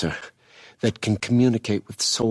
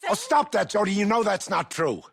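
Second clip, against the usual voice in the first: high-pitched, impatient objection; exasperated protest. Acted. Man telling Jody to stop, exasperated protest. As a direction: cut in, voice pitched right up.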